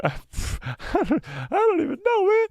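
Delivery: high, laughing squeal of disbelief. Real, not acted. Streamer overwhelmed by the episode, laughing.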